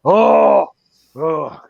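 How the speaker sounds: loud, high "oh" fading away; Frenchman's dismay. Acted. Frenchman reacting to hearing Montreal, acted dismay. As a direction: play up the Frenchman's reaction, then let it drop. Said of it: "the Frenchman is just kinda like".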